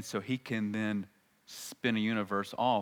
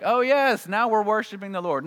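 much higher, louder, a chirpy put-on piety; mock pious Israelites; 10 semitones higher, 7 dB louder than usual. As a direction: parody fickle believers proudly swearing faith. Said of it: "you're like".

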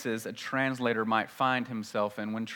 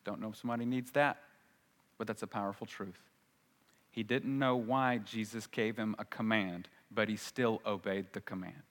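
tender aside; 3 semitones lower, 7 dB quieter than usual. Real, not acted.

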